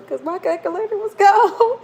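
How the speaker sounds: high, loud burst of laughter into "gone"; laughing. Real, not acted. Vlogger thanking viewer for the math, breaking into loud laughter. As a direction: crack up on the last word. Joking about her missing calculator.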